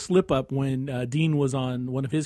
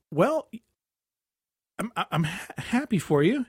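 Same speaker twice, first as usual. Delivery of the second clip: halting, higher, unsure congratulations; hesitant congratulations; 4 semitones higher than usual. Retold or imitated. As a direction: stammer out a baffled, questioning well-wish. Awkward reply to confusing engagement news.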